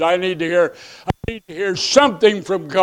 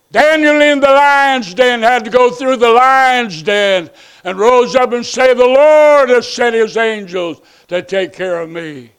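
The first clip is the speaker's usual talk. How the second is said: full-force preaching; 5 semitones higher, 4 dB louder than usual. Acted.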